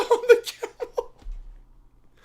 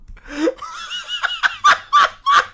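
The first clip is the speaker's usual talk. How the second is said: squealing laugh, high and helpless; high shrieking laugh. Real, not acted. Player losing it at a silly game, shrieking laugh.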